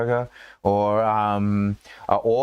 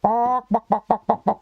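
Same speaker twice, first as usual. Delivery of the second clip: chicken clucking; 12 semitones higher than usual. Retold or imitated. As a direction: flap and cluck like a taunting chicken. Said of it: "He's like".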